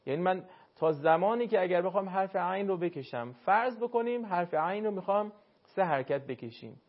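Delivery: ordinary talk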